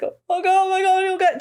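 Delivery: high, frantic "oh my God" whimpering; imitating a panicky small-town mayor. Mayor panicking in retold scene.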